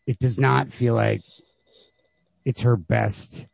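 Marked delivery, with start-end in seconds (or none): none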